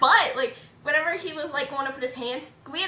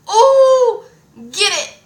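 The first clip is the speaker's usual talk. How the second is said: loud, high, drawn-out teasing cheer; playful whoop; 8 semitones higher, 13 dB louder, much slower than usual. Retold or imitated. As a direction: hoot it jokingly, on the edge of laughter. Jokey cheering retold, then laughs.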